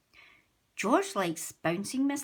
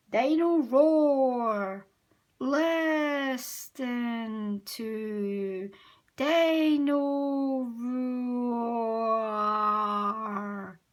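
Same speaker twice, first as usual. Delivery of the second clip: toy running down; 3 semitones higher, 4 dB louder, much slower than usual. A narrator's reading. Toy song winding down, batteries dying.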